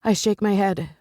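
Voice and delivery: ordinary delivery, nothing marked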